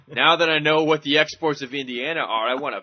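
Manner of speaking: loud, emphatic fan declaration; hammed-up competitive outburst; mock fired-up. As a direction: declare a playful grudge, punching it loudly.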